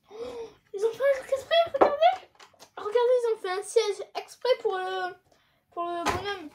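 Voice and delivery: high, loud, thrilled; excited discovery. Real, not acted. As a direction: shout out the discovery. Discovers seat made for figure.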